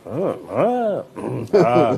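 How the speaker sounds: nervous stammer. Retold or imitated. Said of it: "literally backstage going".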